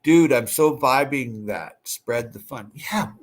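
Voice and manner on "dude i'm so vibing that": usual speaking voice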